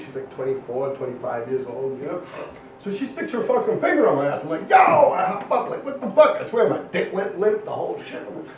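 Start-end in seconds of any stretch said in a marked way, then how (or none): 4.7-6.4 high mock yell of alarm; startled shout. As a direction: yelp like you just got poked. Retelling jumping up in shock.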